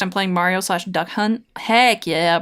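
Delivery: usual speaking voice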